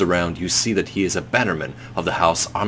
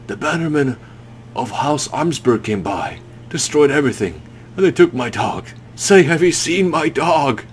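dazed grieving man; 6 semitones higher than usual. Acted. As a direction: ask as if the dog is gone. Narrator voices grieving man.

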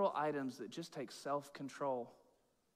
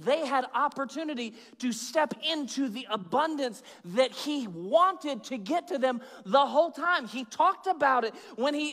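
higher, driving preacher delivery; preaching at full force; 9 semitones higher, 9 dB louder than usual. Acted. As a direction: preach it high and emphatic, punching key words.

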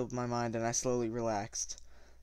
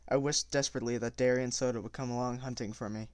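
plain reading voice

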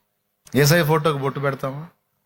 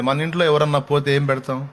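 ordinary delivery

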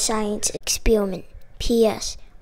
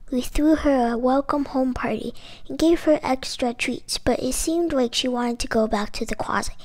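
plain reading voice

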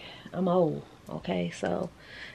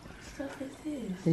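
normal talking voice

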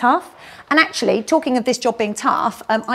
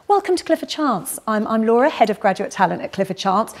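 ordinary talk, nothing marked

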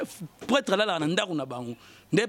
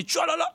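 high, playful splashing sound into the pool; splash noise; 10 semitones higher than usual. Retold or imitated. Bragging about his pool, mimics a splash. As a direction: imitate a body dropping into water.

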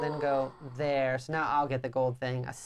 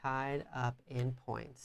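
slow drawn-out murmur; 4 semitones lower, 7 dB quieter, much slower than usual. Pretend. Repeating with emphasis.

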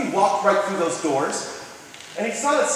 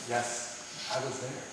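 hushed reply; 7 semitones lower, 16 dB quieter, slower than usual. Retold or imitated. Fireman recalls being at Ground Zero.

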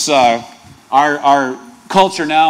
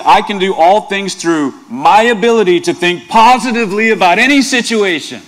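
higher, louder mock positive-thinking pitch; wrong version again; 5 semitones higher, 6 dB louder than usual. Pastor misquoting Paul as a mock self-help slogan. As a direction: sell it like a self-help guru. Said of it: "Still not right?".

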